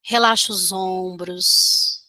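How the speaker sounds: usual speaking voice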